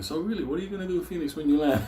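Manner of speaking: high, rapid mock small talk; chatty passenger imitation. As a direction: rattle it off like a nosy seatmate. Mimics Benny chatting up passengers.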